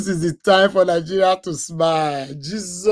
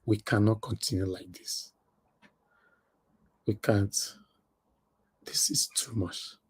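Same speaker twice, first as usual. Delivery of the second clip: whisper; 7 semitones lower, 7 dB quieter than usual. Real, not acted. Overwhelmed by emotion.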